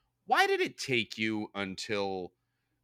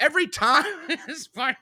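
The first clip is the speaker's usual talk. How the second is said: much higher, laughing through the words; 5 dB louder than usual. Real, not acted. Host breaking up reading a tweet, laughing. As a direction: giggle your way through it.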